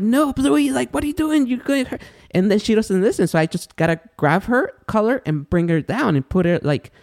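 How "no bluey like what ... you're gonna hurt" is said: much higher, pleading scolding voice; alarmed scolding. Retold or imitated. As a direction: imitate frantically telling off the dog. Retold scolding of a jumping dog.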